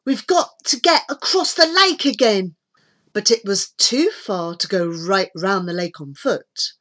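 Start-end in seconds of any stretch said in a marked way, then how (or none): 0.0-2.6 lightly voiced urgent child; higher, louder and pressing; girl in a hurry. A narrator's reading. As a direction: press the others to get moving. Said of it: "said Debbie".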